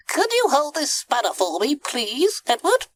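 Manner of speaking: high-pitched, busy little character; high cartoon monkey voice; monkey first mate. A narrator's reading. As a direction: puff it out in a high voice. Mike the monkey.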